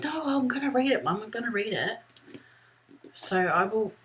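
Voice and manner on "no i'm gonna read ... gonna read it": higher, faster whiny kid voice imitating her son. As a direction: mimic the son protesting, pitched up and quick. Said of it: "he said".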